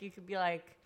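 ordinary talk, nothing marked

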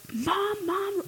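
a lightly voiced yell down the hill; calling down. Calling to mom from the ridge, high call. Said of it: "So I yell down".

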